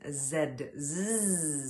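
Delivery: short voiced zz buzz on Z. Shown as a demonstration. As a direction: buzz the Z with teeth nearly closed. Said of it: "Next siren is on the Z".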